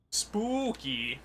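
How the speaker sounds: spooky in audible scare quotes, pitched high; air quotes. Retold or imitated. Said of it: "quotation marks".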